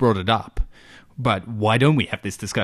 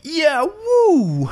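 high squealed woo; over the top kids' show whoop; hammy cheer; 4 dB louder than usual. Acted. Cheesy youth theatre cheer.

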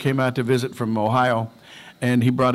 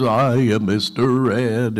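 Mister Ed voice; much slower than usual. Retold or imitated. Quoting the Mister Ed line.

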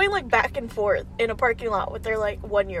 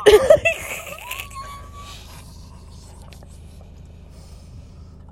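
high squealing laughter; shrieking laugh. Real, not acted. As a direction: burst into a shrill laugh.

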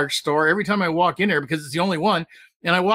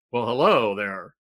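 low suave greeting; 7 semitones lower, slower than usual. Pretend. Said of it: "How am I gonna say it?".

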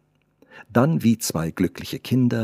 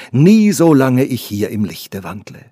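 a louder, ringing promise; loud vow; 3 semitones higher, 8 dB louder than usual. Acted. The artist swears to the girl, loud and ringing. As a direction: declare it so the walls echo. Lover's vow echoing in hall.

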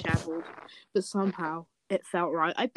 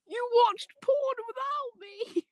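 much higher, slow, mock scandalised cry; mock outraged shriek; slower than usual. Pearl caught out by a friend, mock outraged shriek. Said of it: "I'm laughing at Pearl, going".

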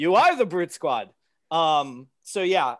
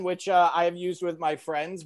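ordinary delivery

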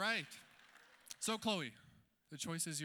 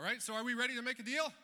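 louder, higher hype call to the crowd; game show host rallying cry; 6 semitones higher, 4 dB louder than usual. Acted. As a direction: pump up the audience for the big moment. Host rallies the audience.

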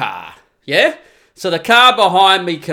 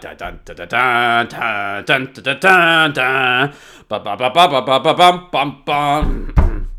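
low, dramatic sung dun dun dun; hummed classical music; 5 semitones lower, slower than usual. Retold or imitated. Mimicking the radio classical music, low dramatic humming. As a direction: hum ominous orchestral music.

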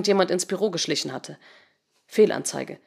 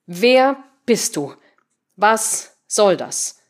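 very slow, word-by-word questions; emphatic demand; 3 semitones higher, 4 dB louder, much slower than usual. Acted. Patricia typing to the intruder, slow emphatic demand. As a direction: spell out each word as if typing it.

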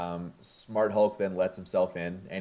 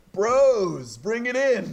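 much higher and louder, arms-wide greeting; hearty welcome; 14 semitones higher, 6 dB louder, slower than usual. Retold or imitated. Drunk Thor greeting his old friends, much higher and louder. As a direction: boom it out like a big drunk bear hug. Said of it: "And Thor says".

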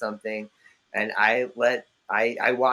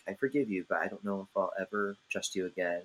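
softer, grave mock mom; a hint of a hurt mother; imitated disappointed mom; 3 semitones lower, 8 dB quieter than usual. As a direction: quietly deliver the stern verdict. Mom's solemn disappointed verdict.

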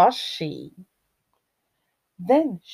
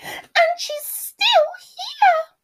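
slow, very high squealed wail; crying Baby Bear; 4 dB louder, much slower than usual. A narrator's reading. Baby Bear finds Goldilocks still there, high slow wail. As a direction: squeal it out as an upset cub. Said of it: "cried Baby Bear".

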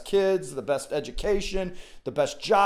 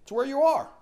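quoted reassurance; 9 semitones higher than usual. Retold or imitated. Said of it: "But he's like".

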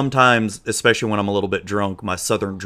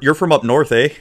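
mock Canadian; 5 semitones higher, 6 dB louder than usual. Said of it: "I'm close to Canada".

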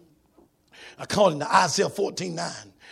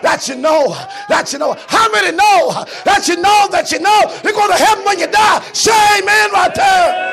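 high sermon shout, building to a roar; rising, shouted pulpit climax; full-force preaching; 12 semitones higher, 13 dB louder than usual. Acted. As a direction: drive each repeat harder.

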